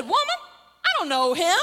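high, loud "Woman," then firm denial; hammed-up indignant protest. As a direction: deny it loudly, affronted. Acts Peter's indignant denial.

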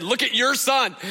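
hamming up the fed-up wife; exasperated wife. Retold or imitated. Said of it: "my wife just sent me a picture and said".

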